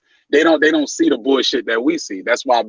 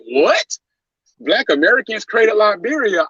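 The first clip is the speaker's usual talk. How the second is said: mock disbelief; 8 semitones higher, slower than usual. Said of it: "They like".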